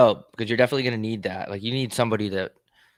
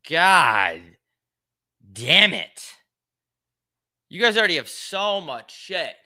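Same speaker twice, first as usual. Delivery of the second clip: exasperated mock outburst; 6 semitones higher, 4 dB louder, slower than usual. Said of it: "I would just be like".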